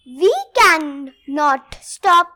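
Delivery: plain reading voice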